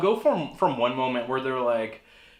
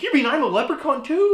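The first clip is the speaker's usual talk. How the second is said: surprised; 12 semitones higher, 6 dB louder than usual. Retold or imitated. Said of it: "he's just like".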